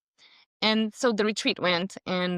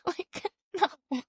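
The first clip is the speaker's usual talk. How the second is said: laughing; 10 semitones higher than usual. Real, not acted. Laughing at her own confusion.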